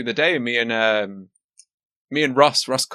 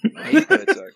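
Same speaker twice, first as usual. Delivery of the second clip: laughing repeat of the title, pitched up; laughing disbelief; 7 semitones higher, faster than usual. Real, not acted. Co-host repeats the joke title, laughing. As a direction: scoff through a laugh at the silly title.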